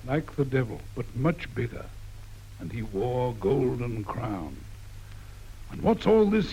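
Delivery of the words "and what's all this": growl. Acted. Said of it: "he growls at us".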